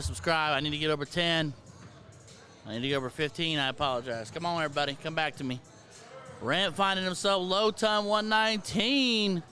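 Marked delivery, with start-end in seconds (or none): 6.4-9.4 play-by-play. Acted. Darts play-by-play commentary.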